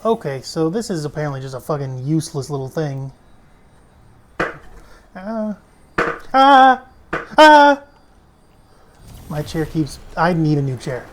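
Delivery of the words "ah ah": loud sudden high yelp; startled yelp. Real, not acted. Gamer's chair slips under him, startled yelp. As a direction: cry out as the chair gives.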